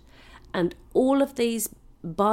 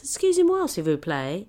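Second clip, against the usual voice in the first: posh mock French; 2 semitones higher, 4 dB louder than usual. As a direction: politely summon the garçon.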